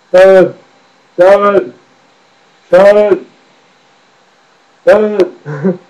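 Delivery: loud rock star calling for his wife; hammed-up rock-star yell. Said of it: "impression of [name]".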